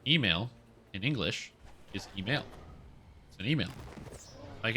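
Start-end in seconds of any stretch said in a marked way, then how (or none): none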